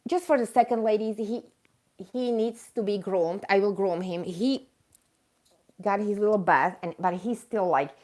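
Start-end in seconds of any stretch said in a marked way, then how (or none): none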